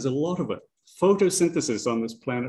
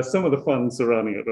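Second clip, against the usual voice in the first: ordinary talk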